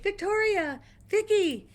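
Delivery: high retold call, drawn to carry; yelling up the street. Shopkeeper calling her friend back, yelling up the street. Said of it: "yells up the street".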